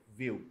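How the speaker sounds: "veu" dropped low and soft; barely sounded syllable. Shown as a demonstration. Teacher showing an unstressed ending, faint low "veu". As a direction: let "veu" almost vanish. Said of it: "o veu quase não sai".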